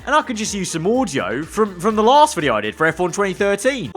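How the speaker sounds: ordinary delivery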